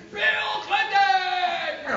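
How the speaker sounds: hammed-up high-pitched holler; starstruck yell. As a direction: squeal the name like an overexcited fan. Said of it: "I was, like, really nonchalant".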